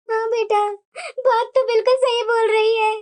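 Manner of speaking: lightly voiced mother. Mother agreeing to pack her daughter's bag, lightly voiced. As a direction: settle it kindly like a mum.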